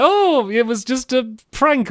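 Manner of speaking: high, drawn-out imitation of a caught trickster; mock excuse. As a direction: wheedle it like a hammed-up excuse. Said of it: "he's all like".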